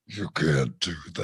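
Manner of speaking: very low, growling Batman reply. Retold or imitated. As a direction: drop to a deep hero growl.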